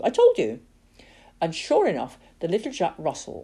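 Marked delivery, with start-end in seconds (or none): 0.0-0.6 higher, triumphant repeat; gloating. Acted. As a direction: crow it a second time. Poacher gloating, repeats himself.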